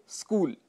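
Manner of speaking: स्कूल starting straight on sk; no vowel before or inside sk in स्कूल; correct pronunciation. Shown as a demonstration. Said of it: "शुद्ध उच्चारण स्कूल होता है". (स्कूल का शुद्ध उच्चारण)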